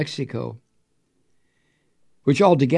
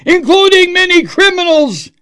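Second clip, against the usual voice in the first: much higher, loud mock yell; all-caps shout; 11 dB louder than usual. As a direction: bellow it like an all-caps tweet. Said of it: "and then all caps".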